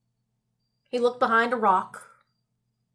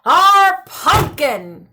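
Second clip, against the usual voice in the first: loud, slow, drawn-out holler; 5 semitones higher, 8 dB louder, much slower than usual. Acted. Old man hollers to the ghoul, loud and slow. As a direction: stretch it out as a holler. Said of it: "hollered the old man".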